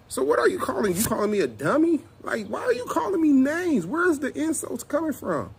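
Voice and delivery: high, indignant retorted questions; firing back offended and high. Retold or imitated. Retold argument, high pitch.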